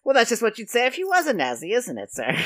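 hint of a knowing yokel catching out the officer; suspicious sign painter. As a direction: lightly play the sly painter doubting him. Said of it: "And the guy say".

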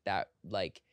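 ordinary delivery

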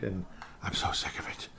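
breathy whisper of disgust; muttered under the breath; whispered aside. Real, not acted. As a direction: drop to a whisper, fed up. Aside amid TikTok rant.

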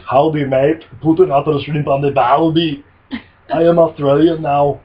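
loud, hammed-up Australian accent as Arnold; a bad Australian accent put on by Arnold; Arnold faking Australian. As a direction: podcaster imagines Arnold in disguise, loud mock Australian accent. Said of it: "Arnold Schwarzenegger trying to be the master of disguise".

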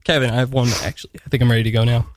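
normal talking voice

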